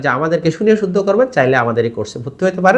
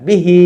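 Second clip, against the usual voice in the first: বিহি with short বি and long হি; quick বি, then hold the হি; stretch on second syllable; slower than usual. Shown as a demonstration. Teacher modelling the word, long হি in বিহি. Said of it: "হিতে টান".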